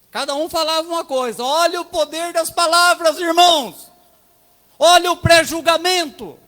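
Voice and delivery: loud, high, ringing sermon cry; preaching at full force. Real, not acted. Preaching: pastor hammers the lesson of words at the congregation. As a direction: drive the point home to the church, full voice.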